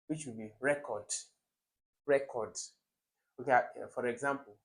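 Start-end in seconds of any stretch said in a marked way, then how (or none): 0.6-1.0 "record" stressed on the first syllable, REC-ord. Shown as a demonstration. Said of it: "the first one is a noun". 2.1-2.5 "record" stressed on the first syllable, REC-ord. Shown as a demonstration. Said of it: "the first one is a noun".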